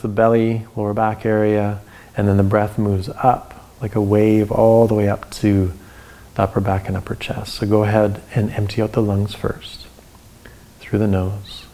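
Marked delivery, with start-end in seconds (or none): none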